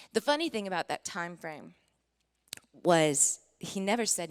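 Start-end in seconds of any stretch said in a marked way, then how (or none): none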